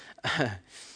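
a short real laugh at his own lines; amused chuckle. Real, not acted. Preacher laughing at his own sermon notes, amused chuckle. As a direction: laugh at what you wrote.

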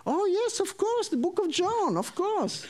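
high-pitched, over-eager mock agreement; feigned recognition. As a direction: pretend you knew it all along. Feigns understanding of unknown book.

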